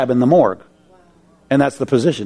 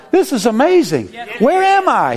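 high, wondering cry of amazement; amazed; 10 semitones higher than usual. Retold or imitated. Man waking in heaven, overcome with amazement. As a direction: marvel aloud at where you are.

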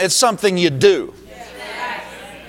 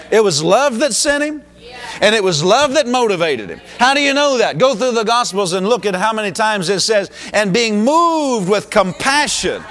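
fervent preaching; 4 semitones higher than usual. Acted. Pastor urging the congregation, raised and fervent. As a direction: build the point with rising fire.

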